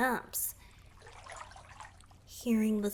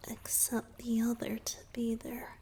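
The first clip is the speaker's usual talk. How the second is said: hushed whisper; 5 dB quieter than usual. Acted. Merfolk explains courting songs to listener in hushed whisper. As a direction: whisper it like a shy secret.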